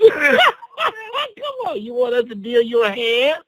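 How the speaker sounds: high, laughing voice for card-playing roaches. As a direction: crack up while voicing the joke.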